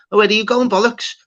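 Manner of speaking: high-pitched mock call-out; imitated comedian's jibe. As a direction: catch someone sneaking out. Acting out comedian heckling a walker-out.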